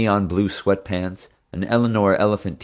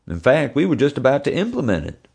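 higher, louder eager voice; eager boy; 8 semitones higher, 3 dB louder than usual. A narrator's reading. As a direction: jump in proudly. Teddy explaining.

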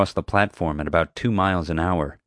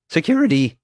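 high, loud guard's call; loud, high-pitched announcement; announcing; 10 semitones higher, 5 dB louder than usual. A narrator's reading. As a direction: announce yourself as a nervous guard. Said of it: "he announced".

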